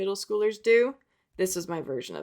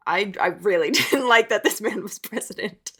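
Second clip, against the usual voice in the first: louder, amused, talking through laughter; laughing; 5 dB louder than usual. Real, not acted. Vlogger finds old Trump comic, laughing as she admits it. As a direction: break into a laugh while speaking.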